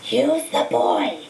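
slow, drawn-out otherworldly command; a disembodied master commanding his servant; Voldemort's eerie voice. A narrator's reading. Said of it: "voice seemed to come from Quirrell".

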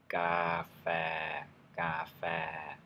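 ka phe said on two level mid tones; Thai mid tone. Shown as a demonstration. Teacher demonstrating the coffee word, level mid tones. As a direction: keep ka phe flat and steady on a mid pitch. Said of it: "That's how you say coffee".

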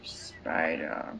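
hushed breathy whisper. Real, not acted. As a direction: breathe it out warily. Spotting a spider warily.

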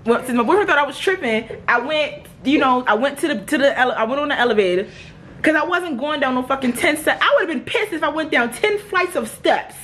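high-pitched, aggrieved venting; worked-up rant. Real, not acted. Worked-up rant about fire alarm.

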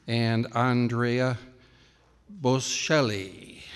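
slow, drawn-out Italian pronunciation; Italian accent. Pretend. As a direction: say the name like an Italian. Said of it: "Italian accent there".

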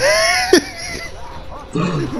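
high shrieking laugh. Real, not acted. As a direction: burst into a squealing laugh. Dying in game.

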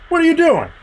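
high, perky little dog voice; corgi's voice. Acted. Game master voicing the corgi, high and startled.

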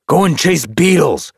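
high, loud dismissal; annoyed shout. A narrator's reading. Tom taunts the dog below the fence, annoyed shout. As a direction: shout it scornfully, unafraid. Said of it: "just annoyed".

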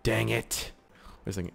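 normal talking voice

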